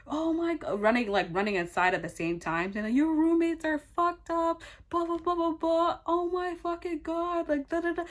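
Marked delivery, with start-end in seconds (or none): 0.0-0.8 high-pitched scream imitation, kept soft; mock scream. Roommate running inside with the stroller, mock screaming. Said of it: "she started screaming". 2.9-8.0 high, sustained imitation of screaming; mock screaming. Roommate screaming while running inside, imitated in a high mock scream. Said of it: "she started screaming".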